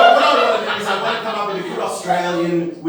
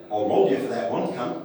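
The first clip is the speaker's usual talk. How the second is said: Eshay; 7 semitones lower than usual. Acted. Eshays giving their house motto, low lightly acted threat. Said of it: "the Eshays' motto would be".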